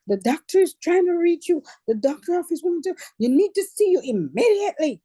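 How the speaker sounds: high, loud urgent voice; frantic message. Retold or imitated. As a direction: sound alarmed and rushed.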